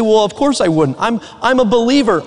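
normal talking voice

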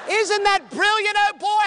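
put-on high, loud excited English accent; English accent of the young man from London, thrilled. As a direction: burst out delighted in an English accent.

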